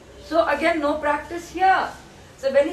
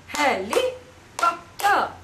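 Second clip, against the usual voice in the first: "Helicopter" very slowly in four separate beats; syllable by syllable; much slower than usual. Shown as a demonstration. Teacher tells child to listen, syllable by syllable. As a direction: spell out "Helicopter" by sound, gaps between syllables. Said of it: "listen to me".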